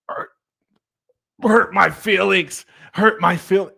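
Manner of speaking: high, loud, halting effort to get words out; mock choked struggle. Man re-enacting admitting hurt to his wife, comically strained. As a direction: force it out as if swallowing a golf ball. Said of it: "I felt like I was swallowing a golf ball".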